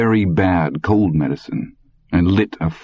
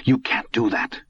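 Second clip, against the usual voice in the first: stunned disbelief; 4 semitones higher than usual. A narrator's reading. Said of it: "in a stunned and unbelieving voice".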